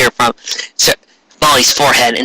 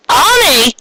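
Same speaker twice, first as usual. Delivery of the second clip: much higher calling out; glad call; 14 semitones higher than usual. Acted. Friend calling to returning Ami, high call. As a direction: call her name running over.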